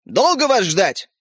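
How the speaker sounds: slow, impatient call from afar; mildly impatient father's shout. A narrator's reading. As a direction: call out slowly to waiting children. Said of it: "крикнул из кухни папа".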